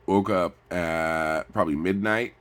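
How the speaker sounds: ordinary delivery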